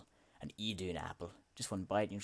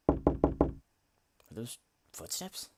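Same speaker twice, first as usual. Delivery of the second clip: alarmed whisper; 4 semitones higher, 9 dB louder, much slower than usual. Acted. Hearing footsteps, alarmed.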